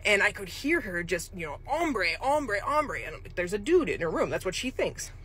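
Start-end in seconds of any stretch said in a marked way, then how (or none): 1.6-3.0 higher, mock cries of an upset old woman; retelling a Spanish-speaking resident calling out; imitating upset yelling. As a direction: lightly voice her frightened calling. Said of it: "she was yelling".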